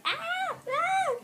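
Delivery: high wailing cry. Retold or imitated. Rachel crying in the basement, a high mock wail. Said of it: "like, crying".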